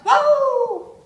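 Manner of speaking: high yelping howl like a dog; imitated dog howl. Dog lying by the fire, high howling yelp. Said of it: "gives out this howling sound".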